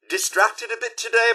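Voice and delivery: ordinary talk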